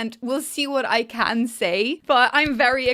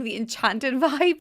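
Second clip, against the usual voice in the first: ordinary talk, nothing marked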